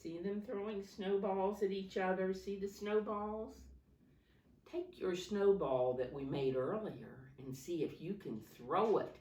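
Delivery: ordinary delivery, nothing marked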